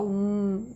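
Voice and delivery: "Hum" as a held closed-lip mmm; isolated M sound. Shown as a demonstration. Teacher showing the lip movement before A, "Hum" as long mmm. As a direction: hum "Hum" as the bare consonant m. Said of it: "você faz um movimento".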